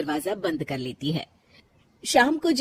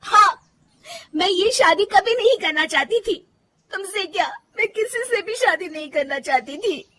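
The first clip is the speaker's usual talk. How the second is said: much higher, loud pained confession; emotional outburst; 11 semitones higher, 3 dB louder than usual. Acted. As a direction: blurt it out, voice pitched way up. Bride bursts out against marriage.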